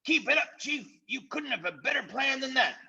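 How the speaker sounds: very high, eager voices; butter up the chief; fawning followers. Acted. Followers praising their chief, very high and fawning.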